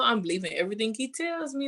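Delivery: ordinary talk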